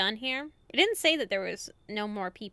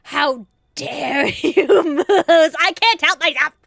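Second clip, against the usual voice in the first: laughing outrage; 5 semitones higher, 14 dB louder than usual. Real, not acted. Player betrayed by Moose, laughing and loud. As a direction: scold through laughter.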